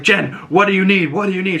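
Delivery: high anxious pleading; mock urgent plea. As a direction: beg her for help, fast. Agatha urgently asks Jen.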